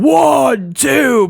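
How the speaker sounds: crowd chanting the count, drawn out. Retold or imitated. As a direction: call out a big pinfall count. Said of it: "Everybody was counting".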